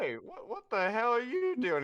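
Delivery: high, loud, laughing protest; high squeaky protest. Acted. Patron jumping on Yuratosh, laughing high protest.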